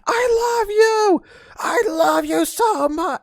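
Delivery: very high, slow wailing shout; man outside yelling at his girlfriend, wailing; mock yelling lover. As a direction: howl it like a desperate boyfriend. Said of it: "screaming at his girlfriend".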